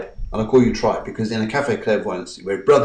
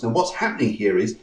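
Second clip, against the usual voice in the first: ordinary talk, nothing marked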